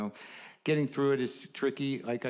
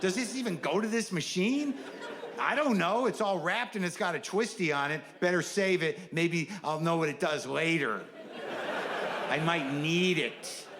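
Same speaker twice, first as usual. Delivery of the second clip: mock baffled muttering; 6 semitones higher than usual. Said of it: "where you're like".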